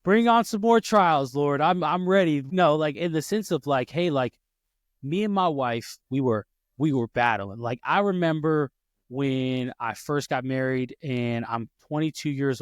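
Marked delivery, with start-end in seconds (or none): none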